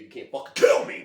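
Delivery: sudden loud burst, taunting; acting out a defiant shout; defiant movie tough guy. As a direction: bellow it like an unkillable film hero. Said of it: "like, Tom Hardy in that frickin' movie".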